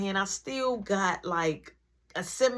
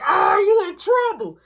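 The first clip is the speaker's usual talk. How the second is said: slow, loud, high teasing singsong; taunting; 10 semitones higher, 8 dB louder than usual. Retold or imitated. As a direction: gloat like he's caught. Classmate taunts him.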